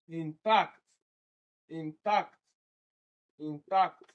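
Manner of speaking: "Intact" said in-TACT, detached; stress on second syllable. Shown as a demonstration. Teacher repeats option A for students, second-syllable stress. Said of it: "It is also the second one".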